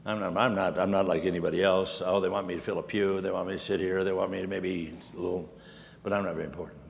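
low, soft, mopey mumble; self-pitying churchgoer. Retold or imitated. Churchgoer who feels unimportant, low mopey mumble. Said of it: "Either you come in here".

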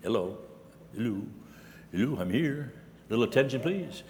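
lightly voiced show-off asking to be noticed; attention seeker. Churchgoer walking in wanting notice, softly mocked. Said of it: "others walk in".

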